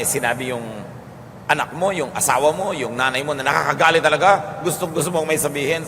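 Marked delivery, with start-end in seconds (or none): none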